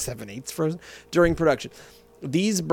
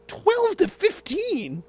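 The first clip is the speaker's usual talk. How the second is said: high, slow disbelieving question; slow high disbelieving repeat of the percentages; incredulous; slower than usual. Real, not acted. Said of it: "incredulous repeat".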